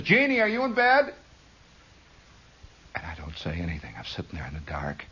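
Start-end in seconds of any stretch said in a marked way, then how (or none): 0.0-1.1 mother's voice pitched high; mother calling. Retold or imitated. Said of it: "she says".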